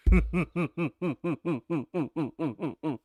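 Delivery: long rhythmic villain laugh; a sinister, rolling cackle; Doflamingo laugh. Shown as a demonstration. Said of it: "Laugh like Doflamingo?".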